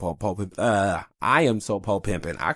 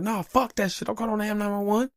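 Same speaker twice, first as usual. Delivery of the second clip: high, exaggerated refusal; imitating himself refusing 911; mock high protest; 13 semitones higher than usual. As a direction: brush off the ambulance in a mock voice. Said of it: "I'm like".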